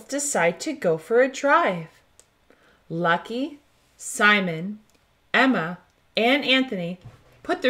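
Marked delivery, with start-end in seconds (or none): none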